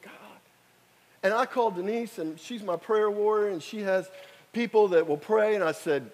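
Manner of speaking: ordinary talk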